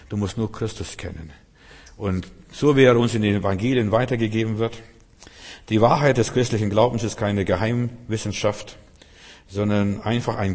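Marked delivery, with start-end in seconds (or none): none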